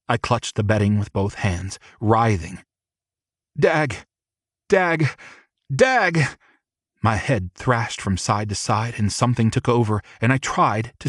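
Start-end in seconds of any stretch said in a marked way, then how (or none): 3.6-6.4 each Dag! climbing higher, building from a cry to a breathless call; rising cries of passion. A narrator's reading. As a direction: let it build, pitch rising with every repeat. Climax, crying out his name.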